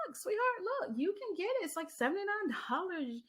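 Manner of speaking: sweet coaxing. Retold or imitated. To Brittany while showing the price, sweet and high-pitched.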